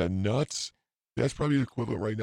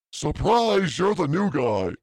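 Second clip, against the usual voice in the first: much higher, louder mock cheer; spring it on the new guy with glee; sarcastic surprise; 7 semitones higher, 4 dB louder, slower than usual. Sarcastic coworker prank line.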